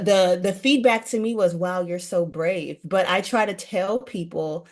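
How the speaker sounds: ordinary talk, nothing marked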